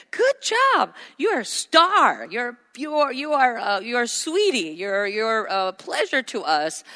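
put-on high, delighted praise for a child; praising parent. Parent praising a child who picked something up. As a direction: gush over the child like a proud parent.

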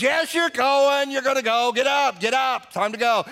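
insistent nagging. Acted. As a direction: badger a reluctant husband, high and urgent. Acted quarrel, wife insists.

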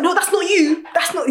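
a hint of a cocky London guy calling her out; London accent. Angry man confronting his catfish date, light London accent. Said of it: "he was proper, like, Londoner".

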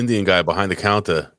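normal talking voice

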